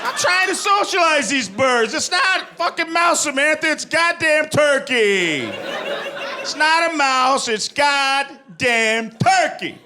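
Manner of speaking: very high strained outburst, slowed; high disbelieving mock shout at Samantha; exasperated Kylo Ren. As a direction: squeal it like a costumed villain losing it. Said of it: "And he just goes".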